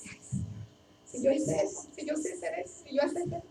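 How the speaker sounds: breathy near-whisper, lamenting to herself; soft whispered self-pity. Retold or imitated. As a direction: murmur it as a private regret. Her private lament, unvoiced.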